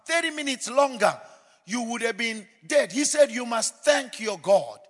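loud, high, ringing sermon delivery; booming pulpit voice; full-force preaching. Acted. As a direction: preach it out over the congregation.

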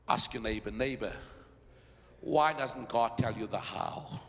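low, soft pulpit confiding; hushed preaching. Acted. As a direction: draw the congregation in with a low, quiet voice. Preacher drops to hushed delivery.

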